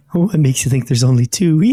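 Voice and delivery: sly mocking creature voice; taunting Jam Jar. Jam Jar taunting the party as he escapes, smug.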